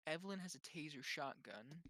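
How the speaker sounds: usual speaking voice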